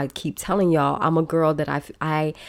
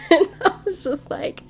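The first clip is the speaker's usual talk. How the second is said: laughing. Real, not acted. Laughing at the memory.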